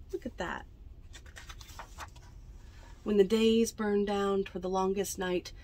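usual speaking voice

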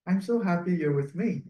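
usual speaking voice

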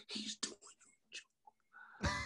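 very soft aside in a whisper; barely audible tattling whisper; hushed whisper. Retold or imitated. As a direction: whisper it under the breath. Whispering to him during show.

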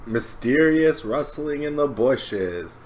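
hammed-up spooky narration; mock mysterious. Repeats phrase in mock suspense.

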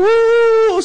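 high, loud whoop; mock crowd whooping; crowd cheer. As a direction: cheer like the excited audience. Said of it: "Nagsisigawan yung mga tao".

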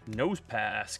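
usual speaking voice

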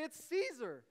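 eager answer. Retold or imitated.

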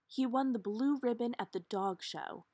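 ordinary delivery

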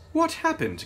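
sharp, high startled cry; jolted into a sudden question. A narrator's reading. Sister startled when the coach halts, high cry.

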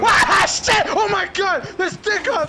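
high panicked outburst; startled cry. Real, not acted. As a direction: cry out as it jumps you. Sudden attack in game.

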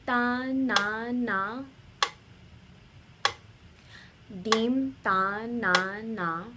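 drilled syllables in even rhythm, gradually joined up; with the metronome. Shown as a demonstration. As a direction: chant the syllables evenly on the beat. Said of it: "do it with the metronome".

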